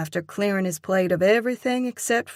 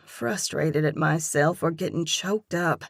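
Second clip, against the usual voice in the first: plain reading voice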